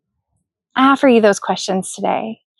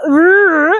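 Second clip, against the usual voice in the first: short mock "rrr" growl, pitched up; imitated growl; 4 semitones higher, 3 dB louder than usual. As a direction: let out a playful growl of irritation. Imitates a feeling bubbling up inside.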